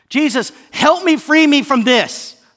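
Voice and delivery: high, loud pleading; crying out. Acted. Believer crying to Jesus for freedom, loud plea. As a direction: call out urgently for rescue. Acted cry for help to Jesus.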